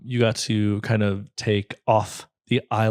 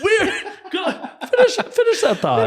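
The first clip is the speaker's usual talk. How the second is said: laughing. Real, not acted. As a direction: say it through giggles. Laughing at silly outburst.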